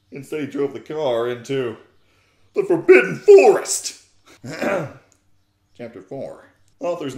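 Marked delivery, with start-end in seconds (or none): 2.5-4.0 loud, higher ominous announcement; dramatic reveal. Acted. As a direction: land it like a cliffhanger. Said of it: "dramatic reveal".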